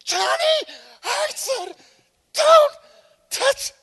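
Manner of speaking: very high, very loud yell; mother's shout. Retold or imitated. Mother finally screams at child, very high and loud. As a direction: blow up at the child at last. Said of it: "all of a sudden it's".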